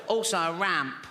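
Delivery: put-on sickly child's voice; funny little voice. Little boy adds to his Christmas list, funny little voice. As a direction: play a bad-chested little boy adding a request. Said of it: "a funny little voice 'cause his all".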